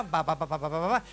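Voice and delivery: low, soft droning ba ba ba; monotone mock reading babble; mock reading drone. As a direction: drone like a student reading off the page. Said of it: "that's reading".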